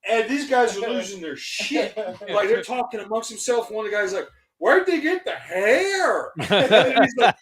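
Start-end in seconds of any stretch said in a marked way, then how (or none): 4.6-6.3 high, slow, drawn-out mock dismay; appalled question. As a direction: imitate a horrified soldier, stretching it out. Retold horrified comms guy.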